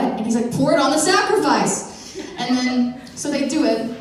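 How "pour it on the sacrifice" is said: "Pour it on the sacrifice" higher and louder; insistent repeat. Retold or imitated. Elijah repeating his odd order to baffled helpers, higher and louder. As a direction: push the order through their doubt.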